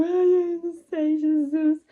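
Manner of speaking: loud, high moans of labor pain; crying out in pain. Retold or imitated. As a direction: plead through a contraction.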